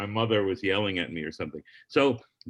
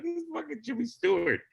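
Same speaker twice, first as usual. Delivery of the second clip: high, hushed awe; starstruck disbelief; 12 semitones higher, 3 dB quieter than usual. Retold or imitated. Comic watching his idol from the wings: high awed hush. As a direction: gasp it out like you can't believe who's there. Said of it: "And I'm like".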